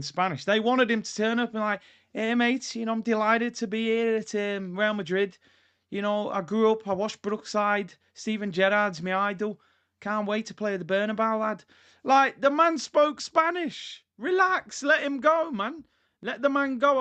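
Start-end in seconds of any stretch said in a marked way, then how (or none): 2.1-11.6 put-on Scouse impression; Scouse accent. Player greeting his new club, in mock Scouse. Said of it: "I thought that wasn't a bad Scouse accent". 12.0-17.0 exasperated. Real, not acted. Exasperated plea to angry fans.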